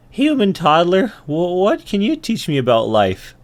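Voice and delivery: high-pitched ant voice; young ant. Acted. Ant questioning a human toddler, high young voice. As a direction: ask it as a half-grown ant. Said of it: "and he says".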